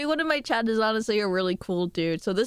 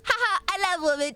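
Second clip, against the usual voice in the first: ordinary talk, nothing marked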